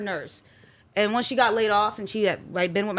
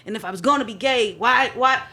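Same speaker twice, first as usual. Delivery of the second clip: ordinary talk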